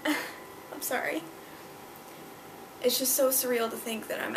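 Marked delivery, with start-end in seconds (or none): 0.1-1.2 choked-up apology. Real, not acted. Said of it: "I'm sorry that I'm getting emotional".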